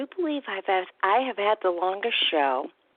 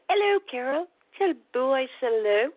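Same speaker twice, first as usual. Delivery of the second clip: higher, lightly voiced caller's greeting; imitating a client; 6 semitones higher than usual. A client asks Carol to greet her dog, in a higher imitating voice. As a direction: chirp the caller's hello up high.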